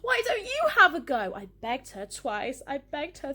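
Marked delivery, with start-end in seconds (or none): 0.0-1.3 loud, high, drawn-out pleading; shouted protest. A narrator's reading. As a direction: beg Mum in a theatrical shout. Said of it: "I shouted".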